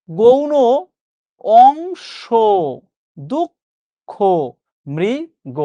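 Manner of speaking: each word said alone, clearly articulated; teacher reading example words; word list demo. Shown as a demonstration. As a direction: read out the word list slowly. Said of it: "শব্দ একটু দাগিয়ে দিচ্ছি".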